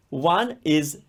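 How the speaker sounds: normal talking voice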